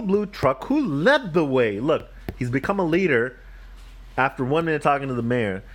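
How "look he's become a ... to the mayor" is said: plain reading voice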